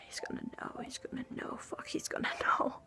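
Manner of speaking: breathless whispered panic, soft; panicked whisper. Retold or imitated. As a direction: whisper it to yourself in dread. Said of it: "Part of me is just like".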